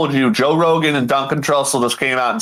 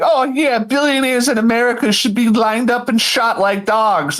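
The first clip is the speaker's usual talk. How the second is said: high-pitched ranting quote; Bill Burr; 7 semitones higher than usual. Retold or imitated. Comedian condemning billionaires, high ranting impression. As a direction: imitate a comic's rant, pitched up. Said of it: "Bill Burr was like".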